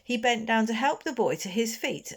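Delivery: plain reading voice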